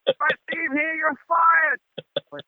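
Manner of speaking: high, acted angry rant; imitated enraged rock star; furious threat. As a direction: threaten the doorman in a rage. Star's furious threat, retold.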